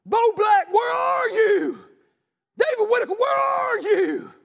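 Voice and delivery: loud, high, slow shouted call; God calling church members by name. Acted. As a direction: call each man out by name. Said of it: "He's saying".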